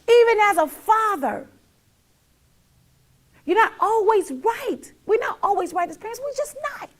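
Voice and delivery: loud, high, sermon-like preaching; full-force preaching to viewers. Real, not acted. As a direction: preach it to the whole room.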